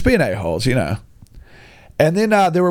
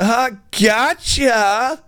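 gloating gotcha; 10 semitones higher, much slower than usual. Retold or imitated. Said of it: "And I was like".

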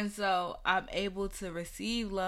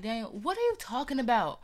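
higher, louder, fast annoyed protest; mock outrage, pitched up and rushed; exasperated retort; 9 semitones higher, 7 dB louder, faster than usual. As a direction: fire back at the friend, fed up. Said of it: "I used to be so mad".

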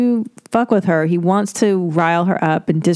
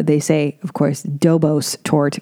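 ordinary talk

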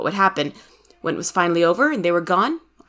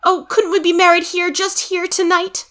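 high-pitched, urgent woman's plea; high, frantic pleading; Daisy crying out; 11 semitones higher, 3 dB louder than usual. A narrator's reading. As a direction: beg in a high, eager voice. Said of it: "cried Daisy, tugging frantically".

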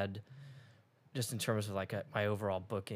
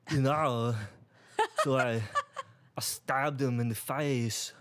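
loud, halting double impression with odd pauses; Arnold Schwarzenegger doing Christopher Walken; 3 semitones higher, 4 dB louder than usual. Trying the reverse impression for laughs, Schwarzenegger as Walken. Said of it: "Arnold Schwarzenegger doing Christopher Walken".

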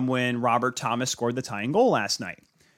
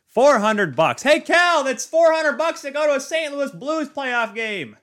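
high, loud disbelief calling out to Cal; shocked at the price; incredulous shout; 13 semitones higher, 5 dB louder than usual. Acted. Mock outrage at ticket price.

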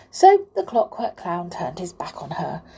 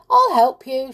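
eager offer; 7 semitones higher, 7 dB louder than usual. A narrator's reading. Amelia offers to meddle, eagerly.

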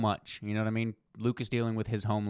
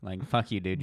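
usual speaking voice

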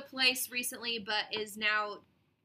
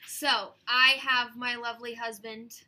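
normal talking voice

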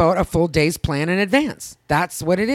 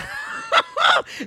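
high shrieking burst of laughter. Real, not acted. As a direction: squeal with laughter. Cracking up at story.

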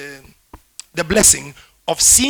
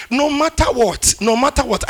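rising, much higher and louder declaration; forceful preaching cry; 7 semitones higher, 7 dB louder than usual. Real, not acted. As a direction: proclaim it with full force. Preaching climax, emphatic repetition.

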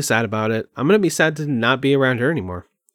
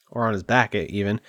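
usual speaking voice

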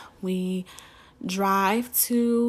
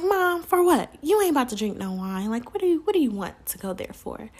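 much higher, louder incredulous outburst; exasperated disbelief; 5 semitones higher, 3 dB louder than usual. Retold or imitated. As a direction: balk at the winery idea. Exasperated daughter questioning mom.

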